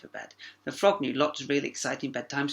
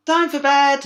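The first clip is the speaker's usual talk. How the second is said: very high, loud call; parents calling; 12 semitones higher, 13 dB louder, slower than usual. A narrator's reading. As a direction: call up the stairs. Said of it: "her parents called".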